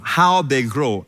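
HOW drawn out slowly; slow, weighty "how they grow"; stress on HOW. Shown as a demonstration. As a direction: stretch "how" for emphasis. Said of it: "Notice the emphasis".

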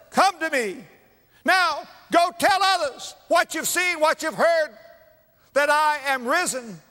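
high, loud, ringing proclamation; joyful Joseph. Acted. Preacher voicing Joseph to his brothers, exultant and loud. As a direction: call it out like a king welcoming his brothers home.

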